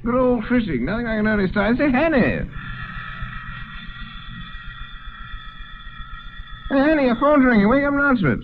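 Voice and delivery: put-on drunk, calling to wake him. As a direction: call up through the door, tipsy.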